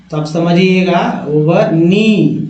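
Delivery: ओवर नी said with no k sound; silent k. Shown as a demonstration. Teacher showing the silent k, ओवर नी said slowly. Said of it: "यहाँ पर के silent है".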